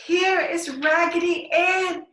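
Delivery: high, loud, slow joyful shout. Acted. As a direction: call out in delight to the house. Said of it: "she shouted".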